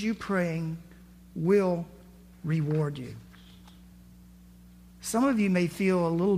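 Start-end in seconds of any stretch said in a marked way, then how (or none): none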